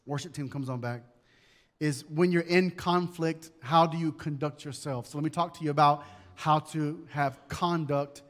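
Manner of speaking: ordinary talk, nothing marked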